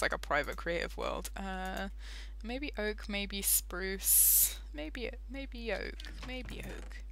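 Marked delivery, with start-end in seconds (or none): 2.4-7.0 mutter. Real, not acted. Muttering over wood choices.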